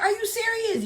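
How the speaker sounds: high whiny mock ex; hammed-up whiny ex. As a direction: squeak it like a pouting boyfriend. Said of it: "He was like".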